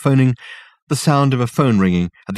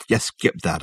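plain reading voice

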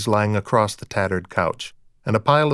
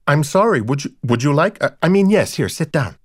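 high, louder flustered apology; startled guilt; 4 semitones higher, 4 dB louder than usual. A narrator's reading. Rita suddenly remembers her guest, in startled guilt. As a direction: stammer apologies as a caught-out hostess. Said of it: "changing at once to a look of startled guilt".

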